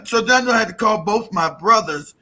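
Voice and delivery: usual speaking voice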